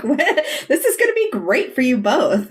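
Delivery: high, gushing encouragement; upbeat, positive mom. Retold or imitated. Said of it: "how positive she is".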